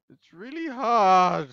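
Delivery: high, slow, wailed protest; loud complaint. Real, not acted. Player complains the game is hard, loud wail. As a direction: wail it out, stretching the words.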